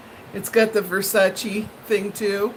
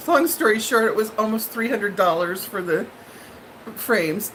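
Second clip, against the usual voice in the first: ordinary talk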